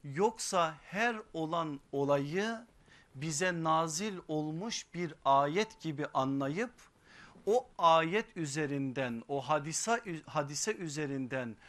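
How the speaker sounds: high, loud, impassioned sermon voice; fiery preaching. Acted. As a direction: preach it out with rising force. Sermon rising to full force.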